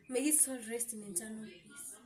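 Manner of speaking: softly, as a blessing for the dead; hushed blessing. Acted. Prayer for the dead father.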